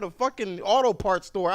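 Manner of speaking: loud, high exasperated yell. Retold or imitated. Driver yelling his location to the operator, exasperated. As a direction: snap it out, at the end of his rope. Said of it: "I said".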